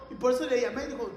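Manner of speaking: ordinary talk, nothing marked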